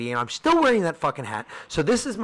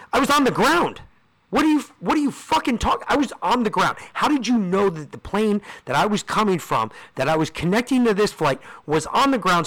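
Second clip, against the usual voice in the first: higher-pitched, incredulous outburst; indignant protest; 6 semitones higher than usual. Retold or imitated. Stranded passenger protesting to the gate agent, indignant. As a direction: act out an exasperated argument, voice raised in pitch.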